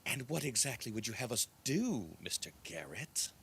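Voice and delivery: softly voiced, pointed old elf woman; an elderly elf questioning, falling away in volume; hushed old woman of the elves. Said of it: "the old elf woman say".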